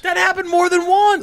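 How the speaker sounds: loud, high insistence; emphatic exclamation. Real, not acted. Storyteller answering a doubting listener, loudly emphatic. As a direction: insist on it at a high-pitched shout.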